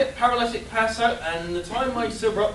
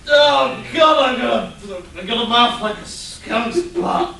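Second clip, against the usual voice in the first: louder, higher, slow groaning complaint; hungover wail; 4 semitones higher, 8 dB louder, slower than usual. Acted. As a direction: moan it like a wretched morning-after. Hungover sidekick groans loudly.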